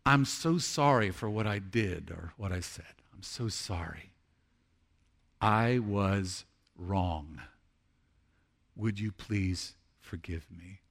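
low, soft, slowed-down apology; measured and contrite, each phrase given room; humble apology. Shown as a demonstration. Preacher showing congregation how to ask forgiveness, humbly. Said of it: "This is what it looks like".

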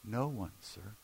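very soft, low answer; small, subdued reply; hushed reply. Acted. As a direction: answer quietly and humbly, voice dropped. Woman's hushed answer to Jesus.

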